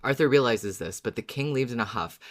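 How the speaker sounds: ordinary talk, nothing marked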